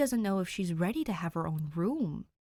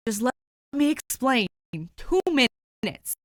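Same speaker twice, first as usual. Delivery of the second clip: higher, louder desperate plea; begging; 5 semitones higher, 9 dB louder than usual. Acted. Dick, begging Danny for two minutes to explain, loud plea. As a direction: plead for just two minutes.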